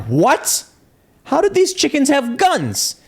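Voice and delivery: high, drawn-out disbelief; incredulous. Acted. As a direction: act out comic shock, pitch shooting up. Imagined farmer stunned by armed chickens.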